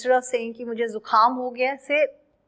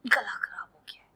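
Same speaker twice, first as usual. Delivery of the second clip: whispered as if the throat hurts; hoarse-throat act, whispered; sore throat whisper; 3 semitones lower, 7 dB quieter, faster than usual. Pretend. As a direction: mime losing your voice.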